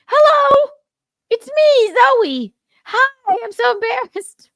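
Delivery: very high, loud mock greeting; Zoe Saldana. Host mocking an actress who stormed the stage, high and loud. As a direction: squeal a hammed-up embarrassed hello.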